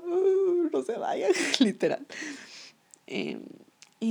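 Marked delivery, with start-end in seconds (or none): 0.0-1.3 high, wailing mock sob; hammed-up teary fangirl plea, laughing through it; mock crying. Fan remembering herself crying at One Direction's last song, mock wailing. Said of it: "yo era llorando en el hospital".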